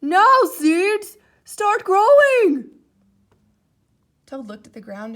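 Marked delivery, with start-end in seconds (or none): 0.0-2.8 loud, slow, high command; said loudly. A narrator's reading. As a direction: order the seeds to grow. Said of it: "said loudly".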